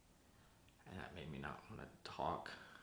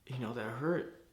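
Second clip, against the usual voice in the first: raised, louder voice pushing out the hurt; 6 semitones higher, 9 dB louder than usual. Acted. Telling the group how brother cut him off, hurt. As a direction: press it out, wounded, rising at the end.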